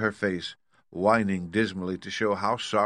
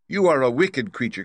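crying out, high and loud; a girl's loud, indignant accusation; Dorothy; 5 semitones higher, 6 dB louder than usual. A narrator's reading. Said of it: "cried Dorothy".

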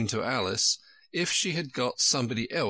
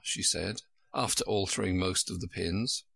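plain reading voice

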